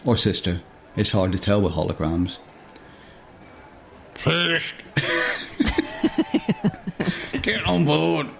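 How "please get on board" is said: high voice breaking into a giggle; a lightly voiced twin, laughing mid-line; raised-pitch hologram that cracks up; hologram figure. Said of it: "says the figure".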